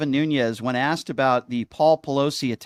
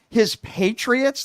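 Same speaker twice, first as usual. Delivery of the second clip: mocking; 10 semitones higher, 3 dB louder, much slower than usual. Mocking the rally goer's phrase.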